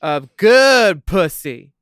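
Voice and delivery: loud, slow, sassy punchline; drawn-out punchline. Real, not acted. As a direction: land the joke slowly. Punchline, stretched.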